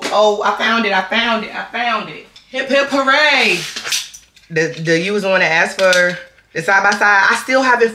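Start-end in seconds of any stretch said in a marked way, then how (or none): 0.0-2.3 excited relief. Real, not acted. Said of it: "finds the camera bag". 2.5-3.6 high, slow cheer; mock-triumphant hip hip hooray. Real, not acted. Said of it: "celebrates finding the bag".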